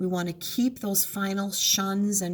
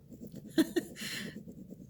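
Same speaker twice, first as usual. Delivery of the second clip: amused laugh; 11 semitones higher, 8 dB quieter than usual. Real, not acted. Laughing at own mistake.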